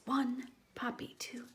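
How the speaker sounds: soft whispered reading; hushed storybook whisper. Acted. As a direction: breathe the words out secretly. Whispered endpaper text.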